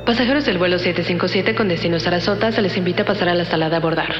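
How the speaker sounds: fast, high airport announcer voice; PA announcement. Acted. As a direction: call the flight like a PA system. Gate boarding announcement.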